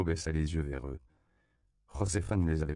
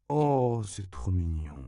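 smiling; 3 semitones higher, 4 dB louder, slower than usual. A narrator's reading. Myriam admires the sleeping boy to Juan Diego, smiling. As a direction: melt over something cute, with a smile. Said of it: "dit Myriam en souriant".

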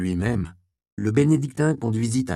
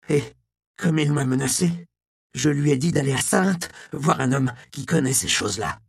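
put-on higher voice of a repentant old monk; old defrocked monk, pleading; 7 semitones higher, 3 dB louder than usual. Monk answering the inquisitor about the Gnostics, anxious and higher. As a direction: justify yourself in a higher, anxious voice.

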